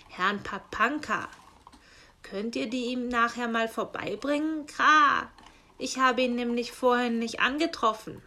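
loud raven voice with harsh caws; croaking raven. Acted. Raven postman grumbles to himself, loud and cawing. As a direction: play the grumbling raven squawking Kra.

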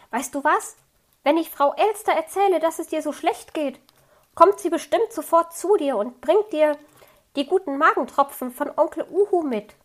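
put-on young fox cub, earnest and worried; a hint of a worried little fox. A narrator's reading. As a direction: pitch it up a little like a small child pleading. Little fox offers cheerfully.

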